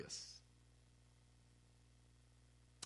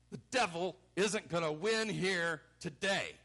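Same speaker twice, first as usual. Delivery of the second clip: loud, forceful declaration; raised-voice defiance; defiant; 5 semitones higher, 8 dB louder than usual. Acted. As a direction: declare it like a battle cry. Voices Jesus defying the devil.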